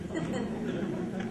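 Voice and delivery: a quiet little laugh to himself; brief amused laugh; soft laugh. Real, not acted. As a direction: laugh softly at the idea. Amused at using child as experiment.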